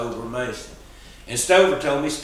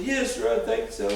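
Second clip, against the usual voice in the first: lightly voiced small boy; 10 semitones higher than usual. Boy answering old man.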